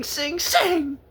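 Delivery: usual speaking voice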